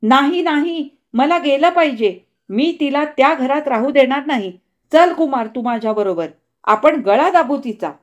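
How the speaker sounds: high, loud frantic screaming while struggling. Acted. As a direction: scream to break free of their hands. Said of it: "ती किंचाळली".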